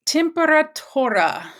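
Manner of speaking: Temperatura said high and loud for the class; modeling the word. Shown as a demonstration. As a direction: announce Temperatura clearly to repeat. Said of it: "teacher models the new word".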